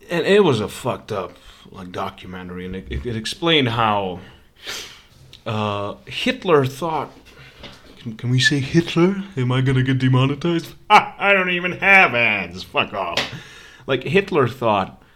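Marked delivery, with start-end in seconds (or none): none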